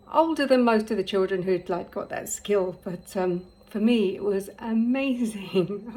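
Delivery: usual speaking voice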